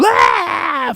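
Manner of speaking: high, loud drawn-out shriek; mock unmanly scream of pain; imitated yell. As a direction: re-enact the shriek, high and piercing. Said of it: "so I do this whole".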